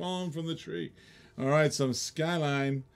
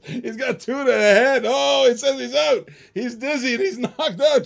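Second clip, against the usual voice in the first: laughing; 10 semitones higher, 9 dB louder than usual. Real, not acted. Laughing through mock knockout.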